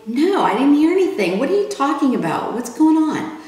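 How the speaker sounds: high, fast, alarmed denial; alarmed protest. Retold or imitated. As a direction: rattle it off, worried and confused. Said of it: "I'm like".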